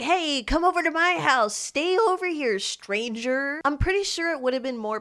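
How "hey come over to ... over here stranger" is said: high, loud creepy come-on; creepy inviting voice. Retold or imitated. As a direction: lure her in, creepy and eager. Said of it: "He's like".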